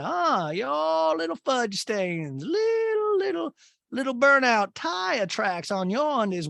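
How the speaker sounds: very high, slow hammed-up mother impression; Patricia. Mother needling her son in front of Madison, high mock scolding. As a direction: gleefully berate in a stretched, swooping falsetto. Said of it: "Patricia kinda casually makes".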